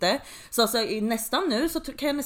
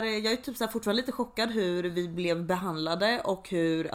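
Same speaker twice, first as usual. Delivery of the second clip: normal talking voice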